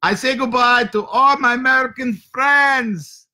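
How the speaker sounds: hammed-up shouted sign-off; shouted farewell. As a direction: shout goodbye to the audience. Shouted sign-off to American friends.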